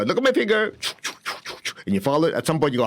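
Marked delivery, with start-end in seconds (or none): 0.0-0.7 higher, bright command; therapist instruction. Retold or imitated. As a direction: lead the client's eyes. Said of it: "And then you go".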